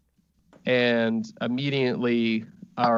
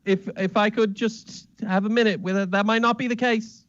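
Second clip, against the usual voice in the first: much higher, fast, fussy pleading voice; a nervous little man begging everyone to calm down; flustered high-voiced Smithington; 8 semitones higher than usual. Smithington pleads with the guests in a high, fast, flustered voice.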